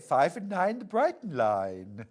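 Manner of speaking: lightly voiced bingo call; bingo caller. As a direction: call it like a bingo hall host. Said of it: "being a bingo caller".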